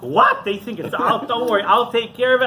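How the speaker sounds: high, loud mock disbelief, then reassurance; acted astonishment. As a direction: feign shock, then take charge. Friend feigns shock, offers help.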